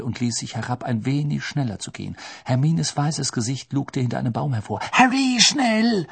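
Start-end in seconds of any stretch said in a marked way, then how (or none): 4.9-6.1 high, loud, drawn-out call; high-pitched hurrying shout; urgent call. A narrator's reading. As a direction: call out urgently, stretching the words. Urgent call from behind tree.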